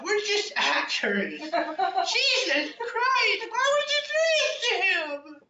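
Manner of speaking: high wailing through laughter; hammed-up sobbing lament; mock crying. A narrator's reading.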